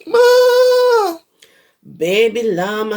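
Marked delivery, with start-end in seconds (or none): 0.1-1.2 much higher, louder call for mama; hollering. Acted. Baby llama calls his mama, high loud holler. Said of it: "hollers loudly for his mama".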